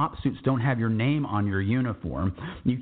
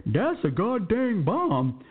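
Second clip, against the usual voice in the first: high, slow, drawn-out exclamation; Sergeant Fears; 8 semitones higher, slower than usual. Retold or imitated. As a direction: put on a high voice and stretch every word. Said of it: "because of the way he said it".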